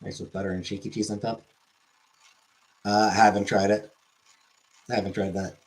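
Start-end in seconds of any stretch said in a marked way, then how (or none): none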